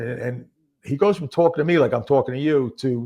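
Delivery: ordinary talk, nothing marked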